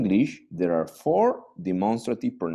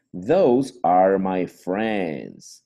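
slow model sentence; 4 dB louder, much slower than usual. Shown as a demonstration. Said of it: "slow model sentence".

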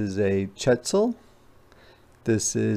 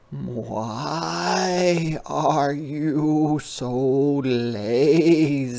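very slow, higher teasing question; jaguar voice; 3 semitones higher, much slower than usual. A narrator's reading. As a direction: drag it out like a sly jaguar. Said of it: "said the jaguar".

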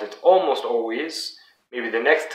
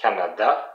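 French "Canada", weight on final "da"; last-syllable stress; 2 semitones lower than usual. Shown as a demonstration. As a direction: demonstrate "Canada" stressed at the end. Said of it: "it's the last part that is the most emphasized".

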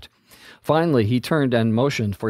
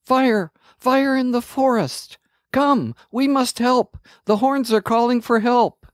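much higher alarmed shout; 12 semitones higher than usual. A narrator's reading. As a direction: shout the warning to everyone. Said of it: "Amanda shouted".

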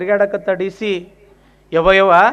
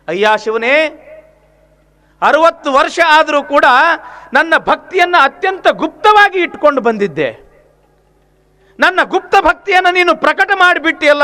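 raised, higher voice rebuking Shiva; angry lament; 7 semitones higher than usual. Acted. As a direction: cry out at Shiva, hurt and angry. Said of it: "ಸಿಟ್ಟು ಬಂತು ನೋಡ್ರಿ ಮಾದಾರ ಚೆನ್ನಯ್ಯನಿಗೆ". (ಶಿವನ ಮೇಲೆ ಸಿಟ್ಟಾದ ಭಕ್ತ, ಏರಿದ ದನಿಯಲ್ಲಿ)

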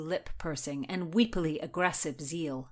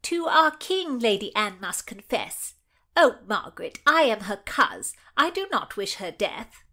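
loud, higher nasal trumpeting; nasal twang; 3 semitones higher, 6 dB louder than usual. A narrator's reading. Madge trumpets about Lady Anne to Margaret, nasal twang. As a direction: trumpet it out through the nose. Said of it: "Madge Shelton's nasal twang trumpeted through".

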